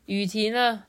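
usual speaking voice

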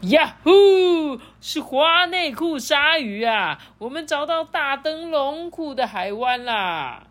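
high whoop, then slow, high-pitched cheer; hammed-up storybook cry of triumph; jubilant pirate shout. A narrator's reading. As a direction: shout the discovery with drawn-out glee. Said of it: "船长们大声喊说".

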